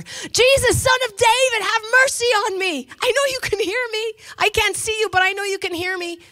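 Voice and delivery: high, loud desperate cry to Jesus; a blind beggar crying out to Jesus, high and loud. Retold or imitated. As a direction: call out to Jesus over the crowd, high and loud. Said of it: "he cried out even louder".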